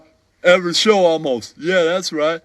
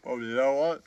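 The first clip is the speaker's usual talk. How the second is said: ordinary talk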